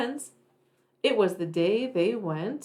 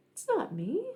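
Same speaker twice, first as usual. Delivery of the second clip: put-on kid denying; 6 semitones higher, 6 dB quieter than usual. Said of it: "Theodore denies, small voice".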